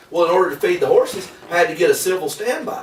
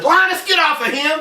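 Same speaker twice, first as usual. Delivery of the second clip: calling off the dog; 11 semitones higher than usual. Retold or imitated.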